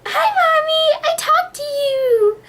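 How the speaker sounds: put-on very high, slow, sing-song child; little kid voice. Imitating her child self chatting to mom on a toy phone. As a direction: chirp it like a tiny girl on a toy phone.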